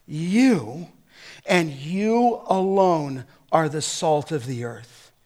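YOU set apart by pauses, slow; emphatic you. Shown as a demonstration. Pastor driving home you alone to listeners. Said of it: "the thought of the language is".

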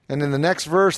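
normal talking voice

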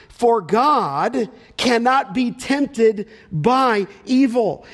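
very slow, each word set apart; emphatic verse reading. Real, not acted. Rereads key verse for emphasis.

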